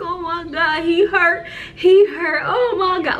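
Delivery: high, loud retold wail; hysterical. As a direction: re-enact hysterics over someone hurt. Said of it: "I'm hysterical".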